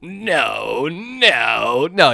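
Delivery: drawn-out, swooping villain refusal; theatrical evil-ruler voice; Palpatine. Shown as a demonstration. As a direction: stretch each word like a scheming villain. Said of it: "I can still do my Palpatine".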